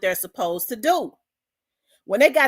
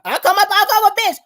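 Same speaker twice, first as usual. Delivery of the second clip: mock pimp boast again; 8 semitones higher, 8 dB louder, faster than usual. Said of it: "I said".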